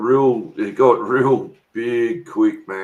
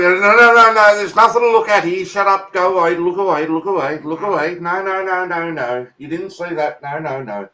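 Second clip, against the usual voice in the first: mock frantic cover-up; 6 semitones higher than usual. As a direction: shoo onlookers away in a mocking high voice, then tail off. Mimicking officials frantically covering up.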